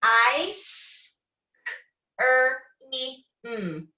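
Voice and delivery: each sound of ice cream said apart; sounded out letter by letter. Shown as a demonstration. Teacher sounding out a word for kindergarteners, one sound at a time. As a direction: segment the word into separate sounds for the kids. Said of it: "We have".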